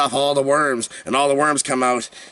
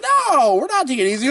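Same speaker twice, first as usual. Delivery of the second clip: mock defiant cry; 10 semitones higher than usual. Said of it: "we were like".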